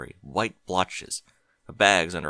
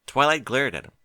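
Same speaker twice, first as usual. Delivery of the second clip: plain reading voice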